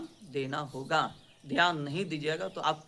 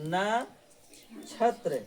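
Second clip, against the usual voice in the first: नक्षत्र stretched out slowly; slow dictation; much slower than usual. Shown as a demonstration. Teacher to class, slowly showing how it is written. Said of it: "ऐसे लिखा है".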